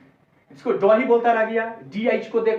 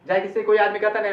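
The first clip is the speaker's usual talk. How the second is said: normal talking voice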